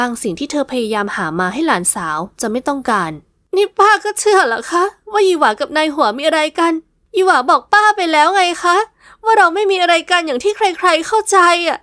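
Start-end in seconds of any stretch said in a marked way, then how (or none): 3.3-11.8 put-on higher, pleading young woman's voice; a niece begging her aunt to believe, tearful plea. Niece pleading tearfully with her aunt, higher voice.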